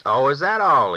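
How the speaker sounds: put-on suddenly cheered old man, perking up; perked right up. A narrator's reading. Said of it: "He perked right up".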